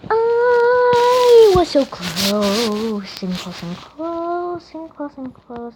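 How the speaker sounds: high, loud, very drawn-out wail; anguished wail. Real, not acted. Gamer lamenting a near miss, anguished wail. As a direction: wail it, stretching the vowels right out. Wails after falling.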